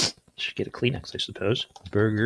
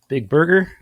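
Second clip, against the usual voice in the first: loud; 8 semitones higher, 12 dB louder than usual. Acted. Typing the design name.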